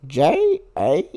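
slow, letter by letter; spelled out slowly. Shown as a demonstration. Asked to spell Jesse, spelling it out letter by letter slowly. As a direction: spell it out one letter at a time. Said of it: "how would you spell Jesse".

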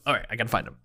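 low, soft self-reassurance; hushed, doubtful repeating; unconfident self-talk. Acted. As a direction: mumble a nervous pep talk to yourself. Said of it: "You don't sound confident very much".

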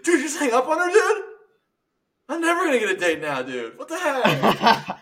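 high, loud tearful whine; mock despair. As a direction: wail it like his love life is over. Acted caller despairs after dispatch hangs up.

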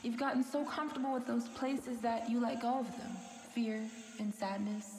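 normal talking voice

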